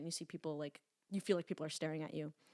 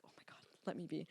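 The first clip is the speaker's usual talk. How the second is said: muttered; 8 dB quieter, faster than usual. Retold or imitated. Exasperated in the rain.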